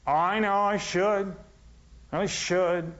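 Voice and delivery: a lightly voiced mock of self-reproach; mock guilty sigh. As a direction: mimic someone nagging themselves. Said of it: "when you say".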